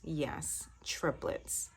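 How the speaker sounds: a hushed, secret whisper; whispered triplets. Real, not acted. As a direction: breathe the word triplets as a whispered aside. Confirms the news in whisper.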